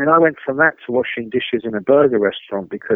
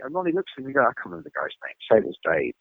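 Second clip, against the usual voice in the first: normal talking voice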